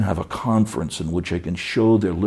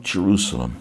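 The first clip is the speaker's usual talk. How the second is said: Jerusalem repeated softer, falling away; soft grieving echo; faster than usual. Retold or imitated. Jesus weeping over the city, soft falling echo. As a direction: let Jerusalem fall into quiet grief. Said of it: "he wept".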